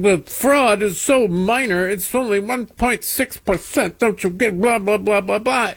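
high-pitched mock politician voice; mocking Angie Craig. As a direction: parrot talking points in a silly high voice. Mocking representative reading talking points.

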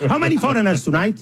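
ordinary talk, nothing marked

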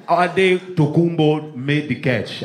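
put-on slow, confident Nigerian-accented call; Nigerian announcer. Nigerian volunteers to announce the catch, proud Nigerian accent. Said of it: "They should have a Nigerian announcer".

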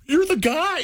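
high-pitched starstruck fan. Retold or imitated. Customer meeting a detailer from their feed, starstruck. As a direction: meet your internet idol, voice shooting up.

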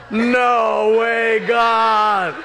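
high, loud, drawn-out refusal; protesting wail. Acted. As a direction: cry out in comic protest, stretching each word. Horrified protest to God's request.

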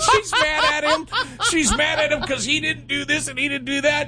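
high, laughing while telling it; real laughter in the voice. Real, not acted. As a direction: giggle through the words. Laughing through retelling.

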